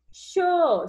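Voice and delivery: cho called out high and loud; syllable called out. Shown as a demonstration. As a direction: sound out cho as one clear beat.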